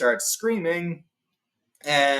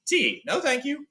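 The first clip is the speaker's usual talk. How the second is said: dialogue voice; 12 semitones higher than usual. Retold or imitated. Dialogue at the tea table.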